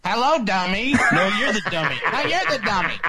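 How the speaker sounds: lightly voiced ventriloquist act, laughing; a hint of a dummy routine, lips barely moving. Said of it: "would go something like this".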